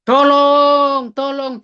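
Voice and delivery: high, drawn-out call for help; retold cry for help. Injured man trying to shout for help, retold cry. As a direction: re-enact calling out, second call dropping away. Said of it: "Lalu saya mau berteriak".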